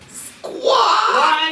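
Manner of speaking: high, loud screeching parrot squawk. Acted. Game master keeps interrupting with a shrill parrot squawk. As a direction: shriek it like a cranky parrot.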